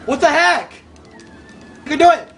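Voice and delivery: ordinary delivery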